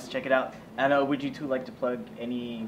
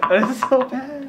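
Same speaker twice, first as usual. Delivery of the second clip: laughing; 10 semitones higher, 11 dB louder, slower than usual. Real, not acted. Laughing at the valley voice.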